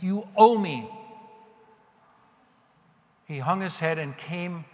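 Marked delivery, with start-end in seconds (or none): 0.0-0.9 loud, raised demand; entitled demand. Acted. Acting out the attitude he didn't come with, loud entitlement. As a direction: insist the debt is yours to collect. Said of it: "with an attitude of entitlement".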